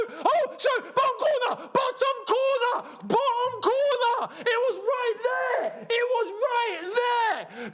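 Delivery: yelling at a missed goal; frustrated yelling. Real, not acted. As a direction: scream at the screen in disbelief. Reacting to a missed chance.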